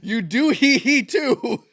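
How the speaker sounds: loud, high, slow shout through laughter; gleeful drawn-out laughing yell; laughing tease. Real, not acted. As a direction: stretch it out while cracking up. Teasing friend about his laugh.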